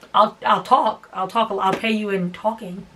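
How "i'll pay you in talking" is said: usual speaking voice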